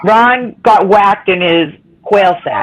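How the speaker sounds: each word of "Ron got whacked in his quail sack" said slowly and with care; slow careful tongue twister. Shown as a demonstration. To the group, the tongue twister said slowly and clearly. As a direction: ease it out slowly so the tongue twister comes out right. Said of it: "That's a tongue twister".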